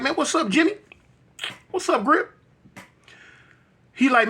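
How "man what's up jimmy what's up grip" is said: casual greeting. Retold or imitated. Retold greeting to his friend.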